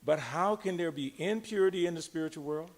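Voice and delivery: ordinary talk, nothing marked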